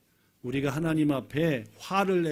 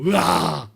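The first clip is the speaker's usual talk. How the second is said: loud mock roar of a devouring beast; lion-like roar; 7 dB louder, much slower than usual. Acting lions about to devour him. (사자처럼 크게 으르렁대는 소리)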